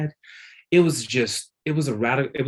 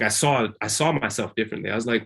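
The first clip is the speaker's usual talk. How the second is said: ordinary talk, nothing marked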